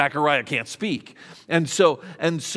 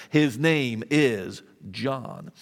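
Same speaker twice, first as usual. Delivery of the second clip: "His name is John" slow and lower, each word set apart; emphatic declaration; 4 semitones lower, much slower than usual. Acted. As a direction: land "His name is John" firmly, with a pause before "John". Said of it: "Zechariah is emphatic".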